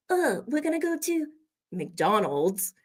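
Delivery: high mock French accent, dropping into American for MCDONALD'S; French accent, switching. French speaker stopping to say it our way, French accent turning American. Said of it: "stop and say".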